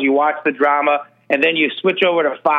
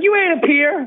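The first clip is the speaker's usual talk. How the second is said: scoffing retort; 11 semitones higher than usual. Retold or imitated. Retorting to Ivanka's quote.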